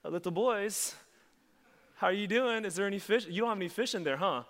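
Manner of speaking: put-on loud, high ribbing, as if to little boys; playful teasing. Jesus ribs his fishermen from the shore, teasing. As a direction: call out high and loud, messing with them. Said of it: "he stands there and he's like".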